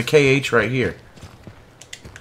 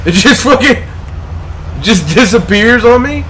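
loud, higher, furious question; angry disbelief; 9 semitones higher, 5 dB louder than usual. Real, not acted. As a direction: shout it, can't believe it. Loot vanished in game.

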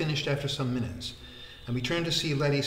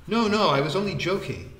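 higher, louder hasty backpedalling; alarmed protest; 7 semitones higher, 7 dB louder than usual. Acted. Stopping Vikram from giving his shirt, protesting loudly. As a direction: wave it off urgently.

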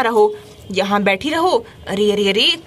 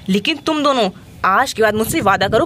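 ordinary talk

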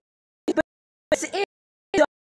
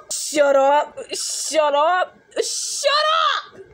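exasperated, building; slower than usual. Acted. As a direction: snap back, each one more fed up. Taunted friend snaps back.

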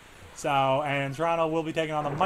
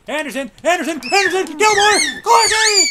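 names climbing higher and louder into a shout; rising excited call; 12 semitones higher, 10 dB louder than usual. Real, not acted. Gamer calling a rush, building to a loud high shout. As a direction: build to a yell on the last name.

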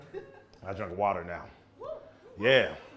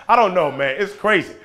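ordinary talk

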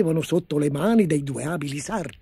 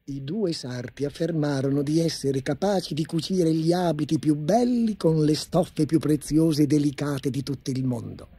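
plain reading voice

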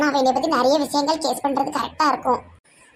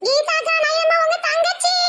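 put-on high, sugary girlish voice; new bride voice; 12 semitones higher, slower than usual. New bride greeting Geetha in a high sugary voice. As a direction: coo like a cheeky young bride.

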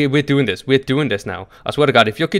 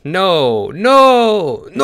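dismayed cry; 6 semitones higher, 5 dB louder, much slower than usual. Real, not acted. Player realises he is the target.

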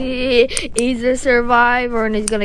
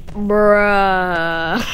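Minecraft zombie sound; 2 semitones lower than usual. Retold or imitated. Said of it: "Minecraft zombie sounds be like".

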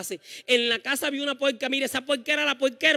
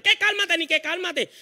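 indignant retort; 7 semitones higher than usual. Retold or imitated. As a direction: snap back at the husband. La mamá le responde al papá. (réplica indignada, aguda y fuerte)

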